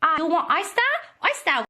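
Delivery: put-on amateur Chinese-accented offer.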